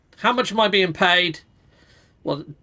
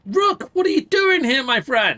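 excited greeting; 8 semitones higher than usual. Pretend. Greeting the returning character Rook.

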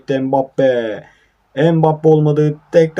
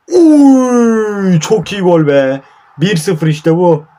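high, loud joyful cheer; goal celebration; 6 semitones higher, 6 dB louder, much slower than usual. Real, not acted. Gamer cheering his own goal, loud joyful celebration. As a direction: whoop for the goal.

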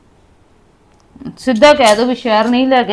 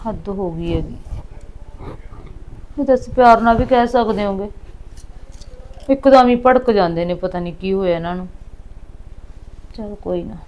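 much softer, lower muttered complaint; grumbling to herself; 4 semitones lower, 10 dB quieter than usual. Acted. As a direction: sulk under her breath alone. Wife grumbles alone after he leaves. (ਹੌਲੀ ਆਵਾਜ਼ ਵਿੱਚ ਬੁੜਬੁੜਾਉਣਾ)

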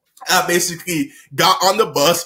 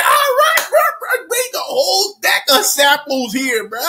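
a hyped-up shout; excited yell; 11 semitones higher than usual. Retold or imitated. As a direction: call out for more like a kid at a feast. His own eager reply.

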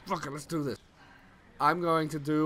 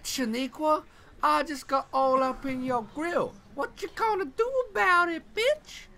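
put-on sassy girl voice; 12 semitones higher than usual.

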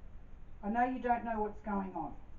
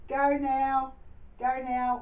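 firm send-off; 4 semitones higher, 5 dB louder, much slower than usual. Acted. Medium sends spirit off.

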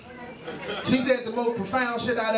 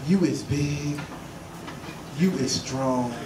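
tender pep talk; 7 semitones lower, much slower than usual. Retold or imitated. Woman tenderly talks to his penis.

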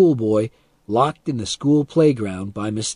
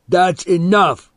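loud, slow, higher-pitched warning; snapping angrily; 7 semitones higher, 6 dB louder, slower than usual. A narrator's reading. Boy has had enough of insults, angry. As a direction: call a halt, loud and drawn out. Boy snaps, about to attack.

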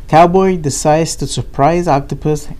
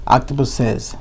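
ordinary delivery, nothing marked

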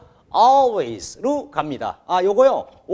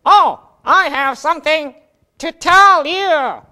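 high, slow put-on voice of the student Jack; Jack's puppet voice; 9 semitones higher, 8 dB louder, much slower than usual. Jack tries his first English sentence, in a high put-on voice. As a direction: play Jack, stretching each word. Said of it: "자, 우선 우리 잭이 꾸며봅니다".

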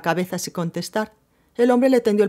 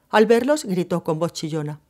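ordinary delivery

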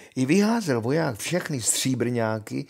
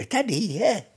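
high, wavering witch voice; witch, obliging; 10 semitones higher, 4 dB louder than usual. Acted. Witch hands over rope. (čarodějnice vysokým, rozkolísaným hlasem)

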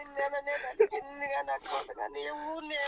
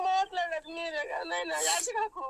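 tearful mother, voice breaking; crying; 3 semitones higher than usual. Real, not acted. Mother on phone with her son, crying. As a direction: comfort him through your own tears.

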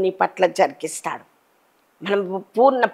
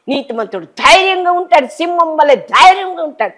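bold proclamation; 6 semitones higher than usual. Real, not acted. Preacher proclaiming boldly.

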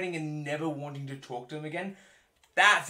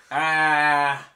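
much louder, satisfied hammed-up sigh; old father-in-law; 14 dB louder, much slower than usual. Father-in-law tasting, mock satisfied. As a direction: savour the word like a connoisseur. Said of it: "Just imagining an old guy".

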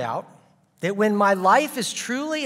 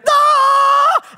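high, loud startled shriek; sudden shrill yelp of fright; terrified scream; 9 dB louder than usual. Retold or imitated. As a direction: shriek like a little girl in panic. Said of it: "We went".

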